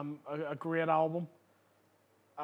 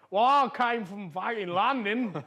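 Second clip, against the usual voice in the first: slow, deliberately wrong London accent; bad English accent; 3 semitones higher, 4 dB louder than usual. Shown as a demonstration. Comic doing an English guy on stage, bad English accent. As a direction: put on a clumsy Englishman's accent. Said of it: "anytime I do an English guy".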